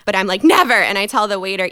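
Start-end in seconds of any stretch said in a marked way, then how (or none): 0.4-0.7 sudden high, loud "Never"; defiant shout. Retold or imitated. Herself defying her own brain, loud and high.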